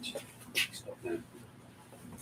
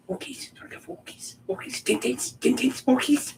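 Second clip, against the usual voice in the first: excited dog calling; 12 semitones higher than usual. Real, not acted.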